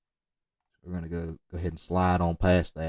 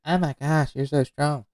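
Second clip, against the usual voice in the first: higher-pitched mock girl voice; imitated impressed girl; 6 semitones higher than usual. Coach acting out an impressed girl, higher mock voice. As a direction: play a girl swooning over his strength. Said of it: "talk to a girl and she says".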